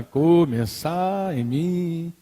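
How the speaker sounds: very slow, stretched syllables; deliberate emphasis. Real, not acted. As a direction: draw out each word for weight.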